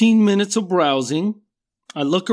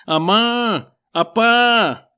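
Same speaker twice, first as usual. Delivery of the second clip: put-on child calling for mom and dad in Spanish, raised and a bit higher; lost kid calling to his parents in the mall, mock calling; 5 semitones higher, much slower than usual. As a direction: call out like a lost little kid. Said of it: "I'm calling for him".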